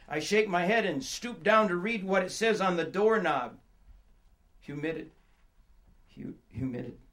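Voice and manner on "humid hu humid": soft, low, hesitant reading to oneself; halting and hushed, feeling for the word; quietly puzzling out a word. Acted. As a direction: sound out an unfamiliar word in a hushed voice. Sounding out words on a doorknob.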